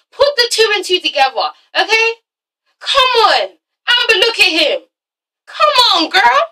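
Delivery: high-pitched mock plea; exasperated urging. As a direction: beg a friend to wake up, pitched high. Urging Amber to see it.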